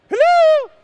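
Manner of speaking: high, loud call across the room. Real, not acted. Calling to the tech guy about the remote, loud high call. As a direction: call out to get someone's attention.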